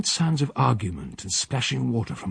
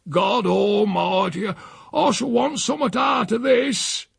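put-on an old man howling at the hot water; Silas bellowing; 10 semitones higher, slower than usual. Silas in scalding bath water.

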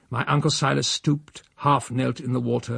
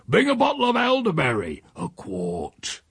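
lightly raised countryman's order, the last words dropped; an old man sending the boy off; old Silas ordering; 5 semitones higher than usual. Acted. Silas to his nephew, ordering wine from the cellar, lightly raised.

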